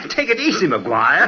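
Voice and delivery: high startled exclamation; jolted, blurt it out. Acted. Startled by collision.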